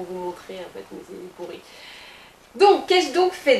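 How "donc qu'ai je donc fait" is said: high, loud, slow and grand; hammed-up grand question; theatrical question. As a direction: ask it like a stage host.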